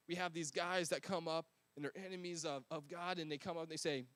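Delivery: usual speaking voice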